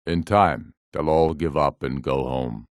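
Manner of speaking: usual speaking voice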